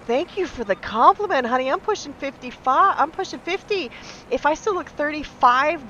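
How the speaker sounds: high, bright mock-delighted thank-you; sarcastic gushing. Answering the insulting patient with high mock delight. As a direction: turn the insult into a fake compliment. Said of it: "And I went".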